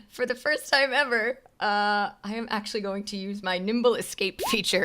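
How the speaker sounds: usual speaking voice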